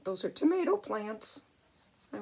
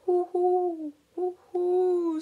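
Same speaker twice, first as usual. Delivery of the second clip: mourning dove call; 10 semitones higher, 6 dB louder, slower than usual. Acted. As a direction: coo like a dove. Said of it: "says the mourning dove".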